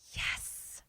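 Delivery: soft, eerie whisper; whispered yes. Retold or imitated. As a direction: imitate answering a ghost under the breath. Possessed mother answers ghost.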